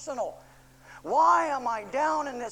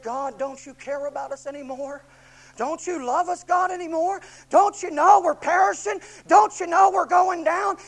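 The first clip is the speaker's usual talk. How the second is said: louder, higher, desperate pleading; panicked disciples; 6 semitones higher, 10 dB louder than usual. Acted. Disciples waking Jesus in the storm, panicked loud pleading. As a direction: cry out in mounting panic.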